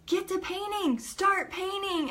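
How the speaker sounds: high-pitched imitated husband urging. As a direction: push her to start, pitched well up. Husband orders her to start.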